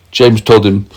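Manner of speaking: usual speaking voice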